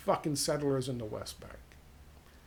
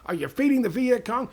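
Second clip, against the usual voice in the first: pushed higher still, louder shout; mock yell, escalating; 9 semitones higher, 8 dB louder than usual. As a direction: crank it up the second time. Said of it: "they start yelling at him".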